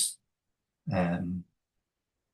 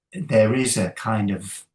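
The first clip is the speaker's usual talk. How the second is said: ordinary talk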